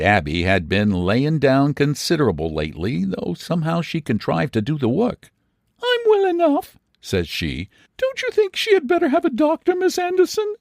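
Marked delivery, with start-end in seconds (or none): 5.8-10.5 put-on high tender old aunt's voice; old aunt soothing a sobbing girl. A narrator's reading. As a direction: comfort a crying girl. Aunt Abby answering.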